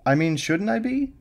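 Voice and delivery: hesitant, hedging; nervous. A narrator's reading. Girlfriend answers his alarm, nervous and high. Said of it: "sounding nervous".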